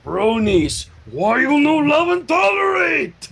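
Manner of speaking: high, slow, shouted broken-English plea; mock outraged shout. Fan scolding the bronies in a high, slow shout. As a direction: yell it at the fans in mock outrage. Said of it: "I'm sorry, I had to say it".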